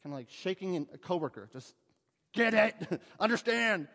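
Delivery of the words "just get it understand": exasperated. Acted. Shaking an exasperated coworker.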